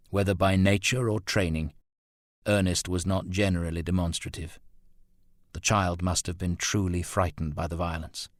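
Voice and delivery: usual speaking voice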